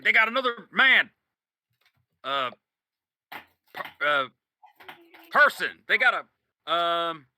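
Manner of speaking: high and loud, fumbling words; alarmed and fumbling for the word; flustered alarm. Acted. Sniper warning boss, loud and flustered. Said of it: "he's like".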